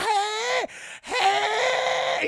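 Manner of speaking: very high, loud shrieking cries; panicked squeal. Retold or imitated. Overseer squealing for help while being beaten. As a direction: squeal in terror. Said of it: "squealing like a bitch".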